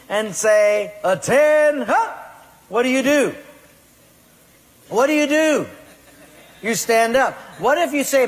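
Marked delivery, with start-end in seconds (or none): 1.0-5.7 barked high and loud attention call; sergeant's command. Acted. Drill sergeant calls recruits to attention, loud bark.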